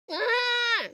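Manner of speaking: high hiccup yelp; sudden hiccup squeal. Real, not acted. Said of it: "Sounds like you're barking".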